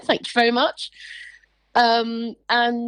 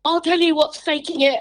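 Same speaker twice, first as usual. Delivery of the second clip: higher, mock furious retort; retold anger; 8 semitones higher than usual. Retelling her angry answer to the guard. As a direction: snap it back at the guard. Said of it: "I was like".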